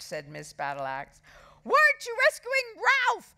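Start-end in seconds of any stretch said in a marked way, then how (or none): 1.6-3.3 high-pitched, loud acted scream; frantic boy shrieking. A narrator's reading. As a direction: scream it as an urgent demand. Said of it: "screamed Henry".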